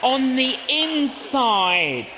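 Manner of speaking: emphatic on the inside; emphatic slow. Shown as a demonstration. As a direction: drive the point home slowly. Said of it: "I didn't let it all out".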